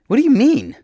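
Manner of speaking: very high, loud desperate question. A narrator's reading. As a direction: demand an answer, voice shooting up. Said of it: "I ask, desperate for a clear understanding".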